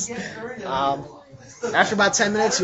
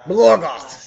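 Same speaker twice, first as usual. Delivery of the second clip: Blorgoth said louder and clearer, correcting; name stressed, corrected; 2 semitones higher, 8 dB louder than usual. Shown as a demonstration.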